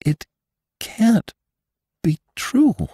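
can't take it in; disbelieving Bess. Acted.

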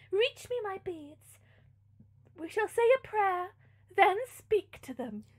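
high, measured noble lady; elevated theatrical diction; composed princess. A narrator's reading. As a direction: reason with the maid, then instruct. Princess reasons with the maid.

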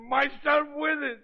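frantic half-shout; rising desperate cry. Acted. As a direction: fling the words out in despair. Desperate man raving about dying.

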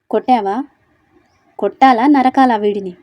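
angry threat. Acted. Siva furious at the thug.

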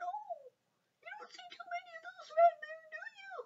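very high, squeezed little frog voice; tiny-mouthed frog. A narrator's reading. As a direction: speak through a tiny pursed mouth. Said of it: "made a smell as tiny as he could".

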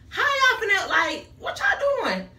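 high, loud, slowed exasperated whine; outraged at the candle shop. Real, not acted. Upset candle sold out.

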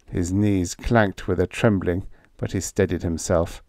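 ordinary delivery, nothing marked

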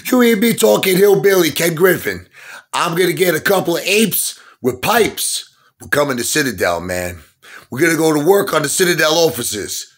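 high, heated movie-style threat; Marcellus Wallace. Acted. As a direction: threaten them like an irate crime boss. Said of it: "pulling a Marcellus Wallace move".

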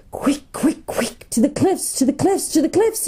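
high, whistling salamander hiss; urgent warning hissed like fire itself. A narrator's reading. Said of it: "a hissing, scorching voice like the voice of fire itself".

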